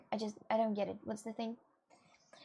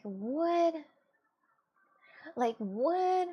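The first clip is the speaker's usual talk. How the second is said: higher-pitched, disbelieving "what"; incredulous; 6 semitones higher, much slower than usual. Real, not acted. Streamer baffled by the visa denial, incredulous. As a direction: exclaim in indignant disbelief.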